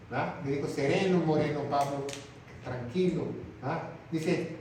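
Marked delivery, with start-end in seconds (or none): 0.7-3.3 soothing calm. Acted. God soothes Pablo, playful rhyme.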